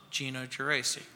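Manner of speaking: low, very quiet name drop; understated punchline, low and soft; hushed deadpan reveal. Acted. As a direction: drop the name softly as the verdict. Announcing himself as the vote winner.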